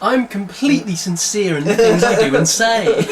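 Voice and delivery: high, loud, hammed-up earnestness; hammed-up sincere vow; mock sincerity. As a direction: overplay being sincere while laughing. Mocking fake sincerity, laughing.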